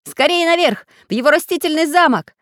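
high, loud, urgent order; rally the troops upward, quickly; commanding. A narrator's reading. Iron man leads charge into castle, commanding shout. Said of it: "скомандовал железный человечек".

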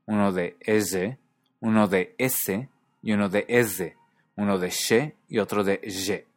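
each sibilant drawn out slowly, ese, she and ye; old Castilian sibilants. Shown as a demonstration. A teacher demonstrating lost Castilian sounds, slowly. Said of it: "tenía un sonido de S".

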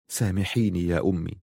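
low, hushed plea for forgiveness; softly remorseful; soft apology. Acted. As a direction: ask forgiveness softly, voice dropped. Plea for his mother's forgiveness.

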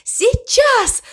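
very high, squealed exclamation; excited cry. A narrator's reading. Cow about to crash to the floor, excited cry. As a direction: cry it out at the top of your pitch. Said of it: "закричала она".

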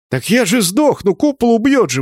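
very high, whiny objection; squeaky, outraged complaint; indignant protest. A narrator's reading. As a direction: protest an order in alarm. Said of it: "возмутился змеевик".

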